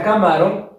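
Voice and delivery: "camarón" said as ca-MÁ-ron, middle syllable stressed. Shown as a demonstration. Teacher rejecting a second wrong form, "camarón" stressed as caMÁron. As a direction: put the weight on MA in "camarón". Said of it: "si yo lo ubico en la sílaba ma".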